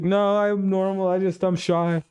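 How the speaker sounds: a shy candidate pleading, voice up high; shy mock voice. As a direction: squeak out an embarrassed excuse. Candidate insists he's just shy.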